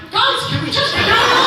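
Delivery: high whiny voice. Retold or imitated. Mimicking Jared whining to leave the set. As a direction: whine it quickly like an impatient kid. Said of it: "Jared is like".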